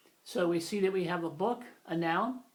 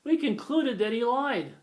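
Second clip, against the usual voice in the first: natural spoken version; 4 semitones higher, 5 dB louder than usual. Shown as a demonstration. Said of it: "we say".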